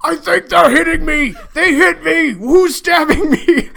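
a big goblin yelping as he's stabbed; wounded Great Goblin. Acted. GM voices the Great Goblin, high and pained. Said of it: "Great Goblin goes".

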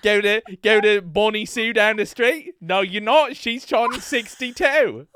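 hammed-up British accent, taunting. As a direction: challenge them in a put-on UK accent. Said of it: "U K listener we've ever had".

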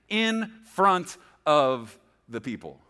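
"in front of the people" slowed, word by word; emphatic repetition. Shown as a demonstration. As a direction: underline "in front of the people" with slow, separated stresses. Repeats phrase to be underlined.

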